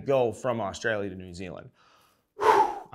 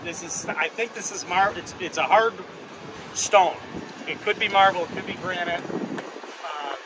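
a higher, eager on-location reporter voice; field reporter; 10 semitones higher, 6 dB louder than usual. Acted. Host reviewing the new statue at Wrigley, as a reporter. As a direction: sell the statue like a TV correspondent.

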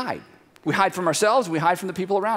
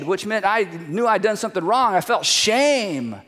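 usual speaking voice

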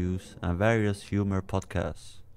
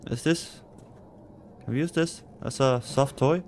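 ordinary talk

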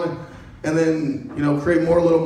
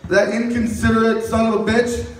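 ordinary delivery

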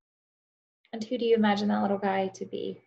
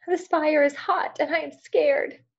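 high, slow, tearful little rabbit; sobbing rabbit; 8 semitones higher, 5 dB louder than usual. Acted. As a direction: plead like a frightened small rabbit. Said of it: "sobbed Rabbit".

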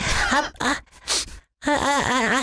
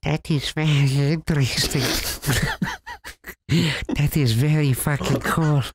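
deep mock voice; 11 semitones lower than usual.

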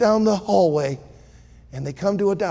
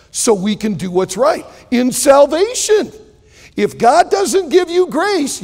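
preaching; 3 semitones higher, 5 dB louder than usual. Acted. Preacher stresses grace to his congregation, fervent. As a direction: press the point home to the congregation.

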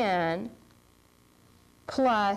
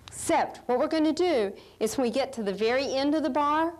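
ordinary delivery

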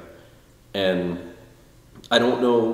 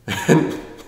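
short laugh; 8 semitones higher, 5 dB louder than usual. Real, not acted. Laughing at fans recognizing him.

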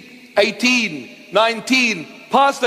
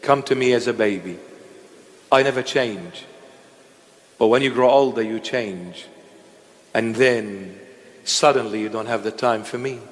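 God speaking tenderly; 9 semitones lower than usual. Retold or imitated. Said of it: "God says".

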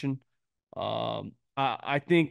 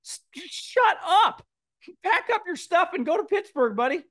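mock angry rant; 14 semitones higher than usual. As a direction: blow up at the rival coach in jest. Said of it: "It's like".

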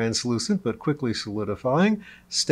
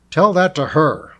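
loud, higher angry retort; growled; 5 semitones higher, 9 dB louder than usual. A narrator's reading. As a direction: throw it back as an angry growl. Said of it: "Lionheart growled".

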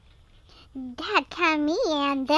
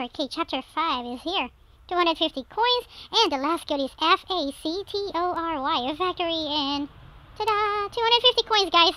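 ordinary talk, nothing marked

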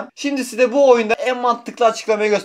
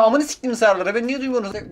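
ordinary talk

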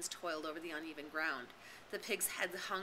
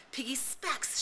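higher urgent shout; 7 semitones higher, slower than usual. A narrator's reading. Ralph shouting for Piggy's glasses, urgent shout. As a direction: bark the order back down the slope. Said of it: "shouted Ralph".

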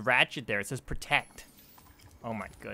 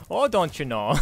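mock Canadian accent, loud and higher; 5 semitones higher, 8 dB louder than usual. Player jokes about how he sounds, mock Canadian accent. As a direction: ham up a folksy Canadian accent. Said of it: "sounds more like a Canadian".

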